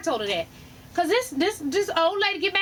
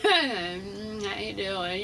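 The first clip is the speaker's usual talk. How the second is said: much lower, very slow and soft; mock flirty greeting; 9 semitones lower, 6 dB quieter, much slower than usual. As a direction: purr it slowly like a come-on. Flirting with passing man.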